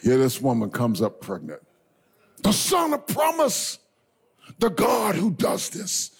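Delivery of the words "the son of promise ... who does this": high, slow preaching shout; full-force preaching. Real, not acted. Preacher proclaiming the son of promise.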